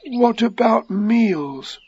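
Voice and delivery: high, very slow, forlorn voice; gloomy. A narrator's reading. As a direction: ask it plaintively, drawn out. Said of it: "said Pooh gloomily".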